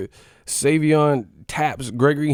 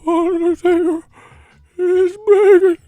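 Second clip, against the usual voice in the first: ghost impression; 6 dB louder than usual. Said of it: "why you sound like a ghost and shit?".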